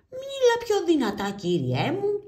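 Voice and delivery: very high, loud impatient call; grocer shouting. Acted. Grocer demands louder speech. (ο μπακάλης φωνάζει ανυπόμονα)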